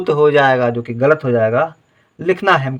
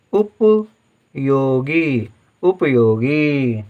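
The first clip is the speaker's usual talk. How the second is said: slow dictation; much slower than usual. Shown as a demonstration. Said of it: "spelling dictation to pupils".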